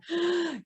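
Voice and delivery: catching breath after laughing; deep breath. A narrator's reading. Woman calming down after laughter, deep gasp. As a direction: draw a deep, recovering breath. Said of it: "she's taking those deep breaths".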